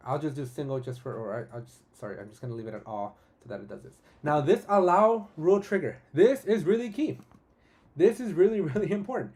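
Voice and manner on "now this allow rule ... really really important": high, loud and eager; really fired up about this; excited. Real, not acted. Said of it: "I'm getting way too excited".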